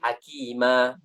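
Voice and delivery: "Hakima" ending on a long open a; pausal ending. Shown as a demonstration. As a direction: stop on "Hakima" with the n dropped. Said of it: "Tidak dibaca".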